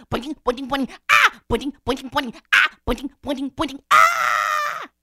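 quick high boings punctuated by loud screams; bouncing and screaming. Acted. Terrified Rabbit flees the hole, boinging and screaming. Rabbit bounding across meadow.

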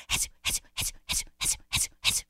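voiceless, rhythmic snake hiss; slithering hiss. Acted. Snake races across the ground, hissing rhythmically. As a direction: hiss in short even pulses.

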